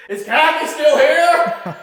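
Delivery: high, loud, drawn-out holler through laughter; mock screaming. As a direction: mimic a needlessly loud call to a spirit. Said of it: "I don't know why I'm screaming".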